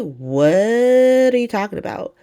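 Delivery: high, stretched-out "What" in mock disbelief; slow, high-pitched retold bafflement; drawn-out disbelief. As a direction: drag out "What" high, incredulous. Acting Willow's disbelief, drawn out first.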